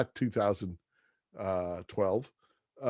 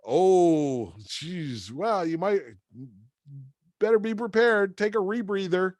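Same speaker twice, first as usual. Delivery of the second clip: much higher, chipper salesman voice; cheery Joe; 7 semitones higher than usual. Retold or imitated. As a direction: sell Milwaukee like a booster. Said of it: "He's like".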